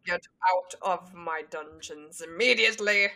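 low, deliberate put-on villain voice; Snape. Co-host as Snape in the background, low imperious voice. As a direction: drop it low, commanding impression. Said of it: "It's Snape in the background".

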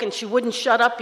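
usual speaking voice

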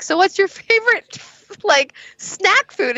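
high-pitched retold question; imitated keen questioner. Scott asking her partner an icebreaker, high and keen.

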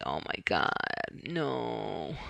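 low, very soft groaned plea; retold dismay. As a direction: imitate a hushed, dismayed reaction. Retold dismay at losing medication.

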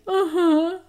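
high, loud mock-whimpered yes; tearful toddler. Upset granddaughter agreeing with grandma, mock tearful child voice.

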